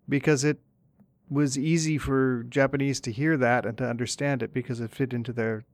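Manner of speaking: ordinary talk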